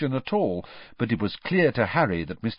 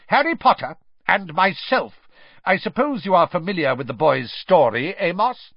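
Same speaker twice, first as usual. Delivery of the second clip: barking; 8 semitones higher, 5 dB louder than usual. Acted. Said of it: "barked Mister Crouch".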